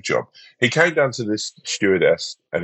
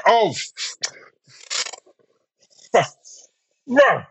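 stuttering swear; 13 semitones higher than usual. Retold or imitated. Polite middle-aged passenger trying to swear at a delay, stammering. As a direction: stammer on the f, high and strained. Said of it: "like they wanna get the F word out".

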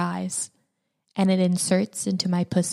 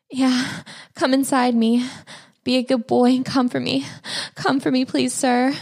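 higher, eager begging; pleading; 6 semitones higher than usual. Acted. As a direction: beg him sweetly, higher pitched. Begging lover near climax.

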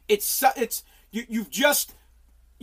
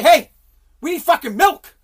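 loud demand; 5 semitones higher, 8 dB louder than usual. Retold or imitated. Acted husband quote.

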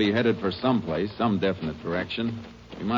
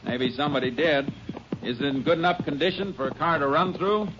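calling out on horseback; 8 semitones higher than usual. Acted. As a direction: call across to your partner while riding. Calling out while riding horseback.

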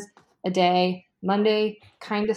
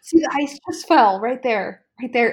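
excited, louder and higher outburst; really excited; 4 semitones higher, 6 dB louder than usual. Real, not acted. Podcaster pointing out the fridge noise to a co-host, really excited. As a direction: blurt it out, loud with real excitement. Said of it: "I just got really excited".

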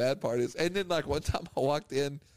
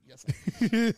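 laughing; 7 semitones higher, slower than usual. Real, not acted. Admitting with laugh.

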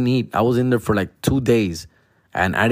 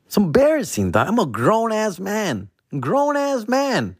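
exasperated whine; 9 semitones higher than usual. Acted. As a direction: whine it, disgusted with yourself. Exasperated high complaint.

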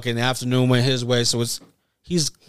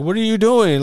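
higher, louder mock wife calling out; playing the puzzled wife, raised up; imitating the wife; 9 semitones higher, 4 dB louder than usual. Wife catches him at the window, higher puzzled call. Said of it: "my lady's over there like".

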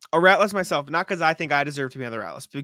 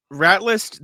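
segment announcement; 5 semitones higher, 8 dB louder than usual. Acted. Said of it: "announcing segment title".

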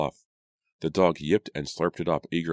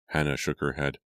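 usual speaking voice

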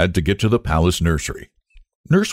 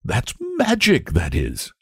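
high on the key word, dropping after; awed wonder; 6 semitones higher than usual. Acted. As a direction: marvel as if barely able to speak. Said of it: "lump of wonder that had got into his throat".

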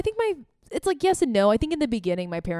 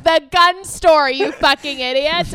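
mock yell; 10 semitones higher, 9 dB louder, slower than usual. Retelling panicked interview mishap.

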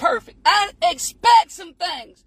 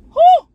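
high, loud whew of release; loud happy whew; joyful whoop; 12 semitones higher, 8 dB louder than usual. Real, not acted. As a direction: let out a happy whoo. Exclaims in joy.

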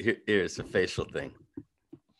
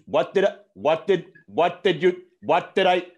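higher, louder tough-guy De Niro; pushy De Niro needling; terrible De Niro; 5 semitones higher, 5 dB louder than usual. Pretend. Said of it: "my terrible De Niro".

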